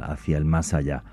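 ordinary talk

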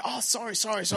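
high, hurried sorry; flustered apology. Retold or imitated. Bumping into a stranger at the bus, high apology. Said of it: "And I'm".

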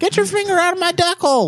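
high squeaky quacking voice; squeaky duck voice. Pretend. Same duck voice.

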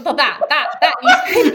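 laughing through the words. Real, not acted. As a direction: giggle while dodging the name. Laughing off a hard word.

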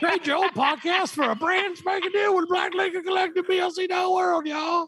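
high laughing shouted promo; wrestling promo. Pretend. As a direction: go full hype commercial. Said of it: "cut a promo for us".

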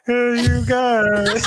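high, very slow drawn-out call; hammed-up doctor summoning the room; mock call-out. Imagined doctor calling others.

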